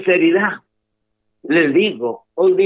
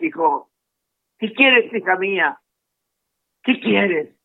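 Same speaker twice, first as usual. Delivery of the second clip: tender asking; 7 semitones higher than usual. Acted. Jesus asking the listener tenderly.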